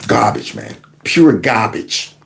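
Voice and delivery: breathy, near-voiceless disgust, slowing on pure garbage; disgusted mutter. Real, not acted. Watching protest videos, he mutters his disgust at them. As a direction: spit the words out in disgust.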